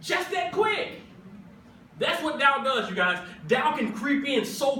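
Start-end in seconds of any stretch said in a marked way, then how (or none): 0.0-1.0 triumphant Jesus. Retold or imitated. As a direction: ring it out in triumph. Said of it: "He says".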